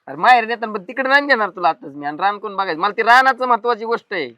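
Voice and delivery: exasperated refusal. Acted. Husband refuses, argues about farm work.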